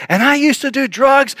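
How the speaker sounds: high, loud imitation of a bold convert; brash testimony. New convert sharing her testimony in church, brash and high. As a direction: blurt it out high and punchy.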